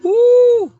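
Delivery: loud high whoop; excited whoop. Real, not acted. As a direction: let out a big happy woo.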